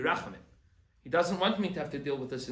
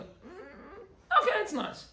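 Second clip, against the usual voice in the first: high, drawn-out lukewarm approval; a condescending pat on the head; unimpressed okay; 12 semitones higher, much slower than usual. Retold or imitated. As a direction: sound politely underwhelmed. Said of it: "You look at it, you go".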